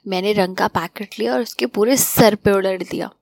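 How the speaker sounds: usual speaking voice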